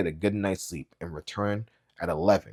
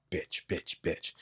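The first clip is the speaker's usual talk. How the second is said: put-on muttering old father; 9 dB quieter, slower than usual. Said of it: "His words emerged in wet bubbles".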